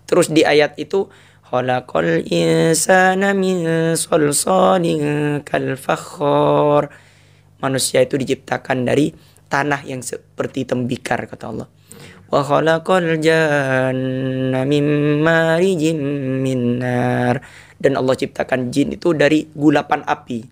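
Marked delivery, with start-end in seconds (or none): 1.5-6.9 slow, drawn-out chant; Quran recitation. Shown as a demonstration. Recites verse. 12.3-17.4 slow, drawn-out chant; Quran recitation. Shown as a demonstration. Recites verse.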